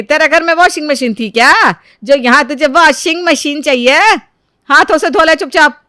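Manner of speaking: loud, harsh scolding; scolding mother-in-law. Acted. Mother-in-law scolds the bride over the washing machine, loud and harsh. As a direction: bark orders at the daughter-in-law.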